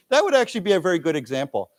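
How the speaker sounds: ordinary talk, nothing marked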